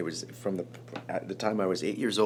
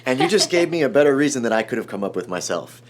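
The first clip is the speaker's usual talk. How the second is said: higher, louder imitation of a director; mock-stern retort; 5 semitones higher, 7 dB louder than usual. Director to the stranger who touched his arm, mock-stern. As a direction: voice the big man's emphatic verdict. Voicing Landis's imagined challenge and approval.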